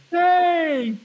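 long high-pitched hail; high mock holler; acted calling out. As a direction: imitate the valet hailing him across a crowd. Valet calls out in story.

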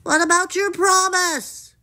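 high, indignant frog call; calling after. A narrator's reading. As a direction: holler after the runaway princess. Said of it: "the frog called after her".

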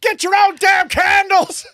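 high, slow, hammed-up yell; comic angry shout. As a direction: bellow it as an outraged sketch character. Shouted parody of the sketch.

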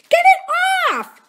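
very high, loud, drawn-out shriek; terrified scream. A narrator's reading. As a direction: scream in pure panic. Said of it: "Drew screamed".

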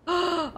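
high, sharp intake of breath; alarmed gasp. Real, not acted. Player realising they are gonna die, frightened gasp. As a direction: suck in air in sudden fright. Sudden danger in game.

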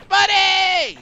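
high, loud delighted shout of a name; excited call. Real, not acted. Streamer spotting a little buddy, high loud delight. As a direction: call out to it, thrilled.

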